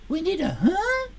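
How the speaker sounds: usual speaking voice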